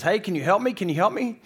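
high, loud, frantic plea; scared. Acted. Dangling tourist calling to the voice above for help, scared. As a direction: beg urgently like someone hanging on. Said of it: "He's scared".

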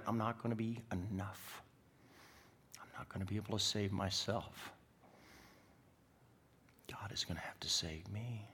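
soft, confessional drop to near-whisper; subdued, earnest pulpit hush; hushed preaching. Real, not acted. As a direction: let it sink quietly into a whisper.

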